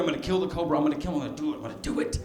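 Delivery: soft, fading to near whisper; hushed self-psyching. Acted. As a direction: psych yourself up under your breath. Psyching himself up to kill cobra.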